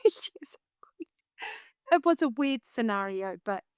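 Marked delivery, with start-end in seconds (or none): 0.0-0.6 a tiny conspiratorial whisper; whispered yes. Real, not acted. Confessing to friends she massaged the prince, whispered. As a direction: breathe the yes like a secret.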